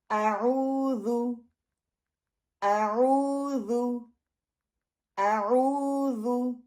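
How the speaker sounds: आऊज़ु very slowly, the "u" held for two counts; slow model recitation of आऊज़ु; slow practice recitation. Shown as a demonstration. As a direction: chant आऊज़ु slowly for the class to repeat. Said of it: "इसको सामने रखते हुए practice करें".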